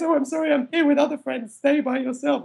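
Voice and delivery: high, put-on brush-off; lightly mocked rejecting stranger. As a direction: imitate a snooty refusal in the panicking voice. Imagined stranger's rejection inside panic.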